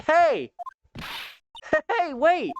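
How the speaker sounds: high call after someone. Acted. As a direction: shout after him to wait. Calling after fleeing kid.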